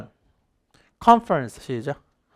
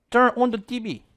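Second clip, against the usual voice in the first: higher-pitched imitation of Mexican-accented English; mock Mexican accent; 7 semitones higher, much slower than usual. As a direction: put on a Mexican accent, closed O in "on". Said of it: "약간 멕시코 사람 영어 하는 거지". (멕시코 사람 영어 억양 흉내)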